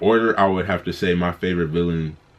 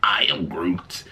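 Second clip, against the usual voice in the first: groot voice; slower than usual. Retold or imitated. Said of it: "he look over like".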